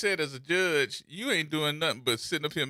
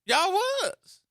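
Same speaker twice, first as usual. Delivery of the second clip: a squeaky put-on voice, much higher; hammed-up high voice; high mock reply; 14 semitones higher than usual. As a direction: answer in a high silly falsetto.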